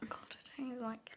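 quick disgusted whisper. Real, not acted. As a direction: mutter it under your breath, quickly. Dreading the next jelly bean.

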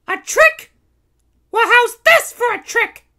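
very high, loud heroine retort; challenging princess. A narrator's reading. Princess Kitty answering the Baron's taunt, challenging. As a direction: throw the taunt back as a cartoon princess.

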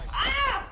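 sharp high startled yelp. Real, not acted. Gamer startled in a match, sharp high yelp. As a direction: yelp as something jumps him. Sudden startle mid-game.

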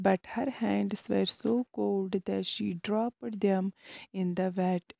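ordinary delivery

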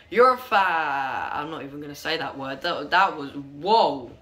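ordinary delivery

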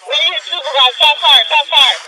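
real ceasefire shout. Real, not acted. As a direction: call the ceasefire at full volume. Officer calling ceasefire after shots.

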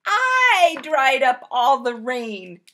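loud, slow, stress on I; slow loud emphatic correction; indignant insistence. Acted. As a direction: insist it was me who did it.

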